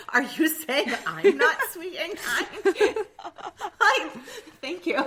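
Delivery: high, loud, slow mock indignation with a laugh; mock offended. Teasing friend who called someone else sweet, mock-offended laugh. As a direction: fake outrage, stretched out and laughing. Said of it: "I'm like".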